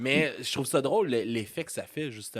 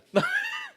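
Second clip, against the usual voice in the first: shrieking laugh. Real, not acted. Host laughing at his own embarrassing lyrics, high shriek. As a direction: let out a shrill shout of laughter.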